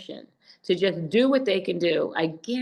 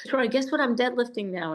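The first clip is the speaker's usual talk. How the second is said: higher, teasing boast to a gym buddy; teasing brag; 6 semitones higher than usual. Retold or imitated. Bragging to the football guy, teasing. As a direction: dangle the news like a proud tease.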